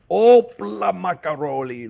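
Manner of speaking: playful exclamation. Real, not acted. Cheerful flip of the roast.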